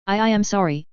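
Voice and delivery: ordinary delivery, nothing marked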